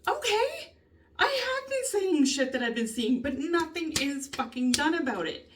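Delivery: high-pitched, fed-up protest; exasperated. Real, not acted. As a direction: pitch it high in real frustration. Venting at being ignored.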